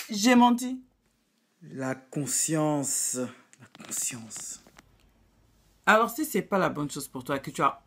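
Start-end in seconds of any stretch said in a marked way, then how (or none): 0.1-0.8 louder challenge. Shown as a demonstration. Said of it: "repeats louder". 1.6-3.1 slow emphasis. Shown as a demonstration. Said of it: "dwells on the word". 3.6-4.6 "la conscience" breathed out slowly in a whisper. Shown as a demonstration. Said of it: "repeats as whisper".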